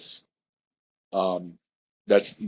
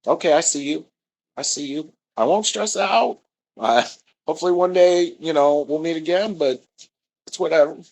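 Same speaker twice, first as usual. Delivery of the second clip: unbothered rival; 7 semitones higher than usual. Retold or imitated. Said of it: "it was just like".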